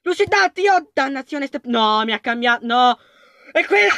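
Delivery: ordinary delivery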